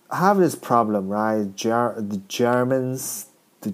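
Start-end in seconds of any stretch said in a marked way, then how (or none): none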